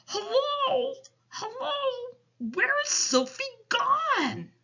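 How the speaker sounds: high wailing call, drawn out; mock shouting. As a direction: giant imitating frantic parents calling out.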